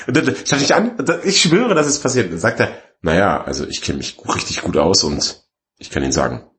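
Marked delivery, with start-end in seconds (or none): 3.0-6.4 low voice, slowing and softening at the end; imitated technician's gloomy verdict; ominous technician. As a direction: confide it deep and grave. Technician voiced in retold exchange.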